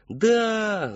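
long high gleeful shout; squealed chorus answer; children yelling back. A narrator's reading. Children cheer on the clown, yelling. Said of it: "заорали в ответ дети".

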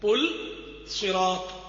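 "پل صراط" as two separate words, pul, pause, sirat; deliberate "پل صراط", closed l then pause; correct pronunciation. Shown as a demonstration. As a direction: break after "پل" with no e. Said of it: "لام پر جزم ہے".

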